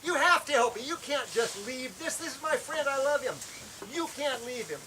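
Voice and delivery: praying for a friend on the cliff, frantic; frantic prayer. Retold or imitated. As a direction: beg the Lord urgently. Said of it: "Now I'm praying frantically".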